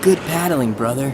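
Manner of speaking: cheerful adventure-serial brother; hearty older brother. Acted. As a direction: boom it out like a proud schoolboy hero. Older brother character speaks.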